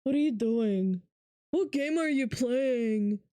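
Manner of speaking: slow, dopey mock viewer voice; mock chatter drawling the question; mocking dumb viewer. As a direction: play a clueless chatter, drawn out. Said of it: "they're like".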